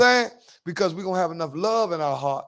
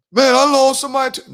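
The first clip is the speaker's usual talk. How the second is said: mocking quote; 10 semitones higher, 9 dB louder than usual. Mocking people who compare losses.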